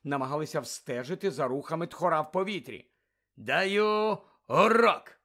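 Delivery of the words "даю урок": explaining. Acted. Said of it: "пояснював Муді".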